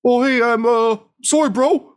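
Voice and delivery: high, loud, quavering apology; hammed-up tearful high voice; trembling voice. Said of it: "in a trembling voice says".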